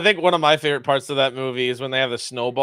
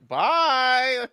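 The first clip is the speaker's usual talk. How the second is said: high, sing-song goodbye in a put-on voice; squeaky elf farewell, much higher; Will Ferrell impression; 9 semitones higher than usual. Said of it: "Will Ferrell keeps like".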